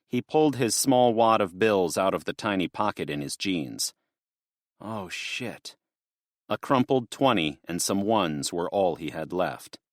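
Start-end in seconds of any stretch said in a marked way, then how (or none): 4.8-5.7 barely voiced curse under his breath; quiet curse. Garth alone at the pump, muttering quietly. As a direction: mutter it to yourself in dismay.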